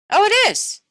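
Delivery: student finding it on page forty-two, delighted; surprised. Pretend. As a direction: react like you just found it on the page. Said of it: "surprised discovery".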